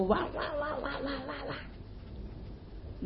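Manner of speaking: quick, high barking woof imitation; guard dogs barking at the foxes. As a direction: bark rapidly like a big dog. Big dogs bark at fox.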